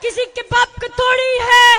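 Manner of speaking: high, loud slogan shout; show of strength. Real, not acted. Speaker asking crowd to show strength, loud chant. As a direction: belt out the call to the crowd. Leads call-and-response slogan.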